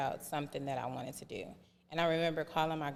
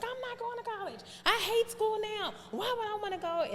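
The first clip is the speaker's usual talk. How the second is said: much higher, defiant and sulky; sulky teen self. Retold or imitated. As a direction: toss it back like a defiant teenager. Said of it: "And I was like".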